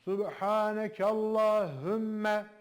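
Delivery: slow, full Subhanekallahümme from the gut; from the belly. Shown as a demonstration. Teacher urging students to let the chant come from the belly. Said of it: "göbekten gelsin".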